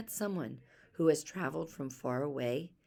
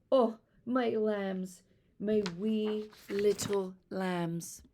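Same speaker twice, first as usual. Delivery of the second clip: slow, tender relieved mother; mother finding her lost children; 5 semitones higher, 5 dB louder, much slower than usual. Acted. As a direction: draw it out with loving relief.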